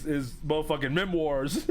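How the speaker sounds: ordinary talk, nothing marked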